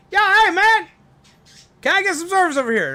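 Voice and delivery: shrill-free loud call over the counter. Acted. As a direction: yell across the room for service. Duck calling out in restaurant.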